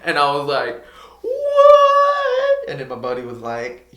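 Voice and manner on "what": a huge, much higher and louder shriek of disbelief; shocked what. Retold or imitated. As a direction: scream it in total disbelief. Cop lets him go unexpectedly.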